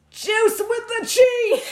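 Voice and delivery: high, slow, gleeful cry; excited shout. Real, not acted. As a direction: call it out with glee. Excited recall of strain.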